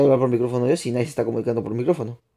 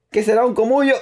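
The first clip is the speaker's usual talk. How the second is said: "comullo" said much higher and rising at the end; incredulous repeat; 9 semitones higher than usual. Real, not acted. Streamer puzzling over a chat word, high incredulous repeat. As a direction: ask it again in high disbelief.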